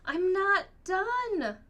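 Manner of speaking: high, slow, loud wail of protest; a child protesting loudly, drawn out; child crying out. A narrator's reading. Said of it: "cries Amy".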